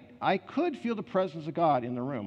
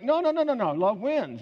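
mock insistent protest; 9 semitones higher, 4 dB louder than usual. Mock protest of expected ending.

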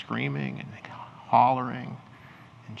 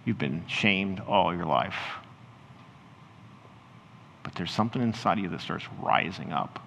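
ordinary talk

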